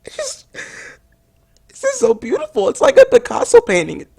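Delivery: very high, squeaky sobbing praise; hammed-up weeping, voice shooting way up; tearful, choked up. Reviewer moved by a beautiful game, tearfully choked up. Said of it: "voice is choking up".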